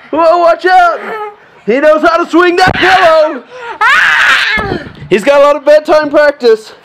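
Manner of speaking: high, loud sportscaster voice; play-by-play commentary. Acted. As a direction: call the fight like a wrestling match. Dad commentates pillow fight.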